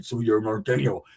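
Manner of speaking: low mumble through a heavy mustache. Retold or imitated. Mocking the big man's mustached talk, low and muffled. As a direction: say it low, as if mustache covers the lips. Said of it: "the word norteño through a mustache".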